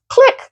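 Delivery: a sharp high click sound of a phone hung up; imitated hang-up click. Hanging up on the stranger, a high mock click. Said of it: "so no click".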